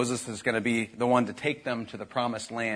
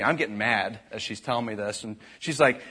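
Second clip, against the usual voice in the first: normal talking voice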